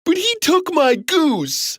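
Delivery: high-pitched protest; whining child giant. A narrator's reading. Giant son to his mama, protesting. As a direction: complain like a wronged kid. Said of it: "cried the giant".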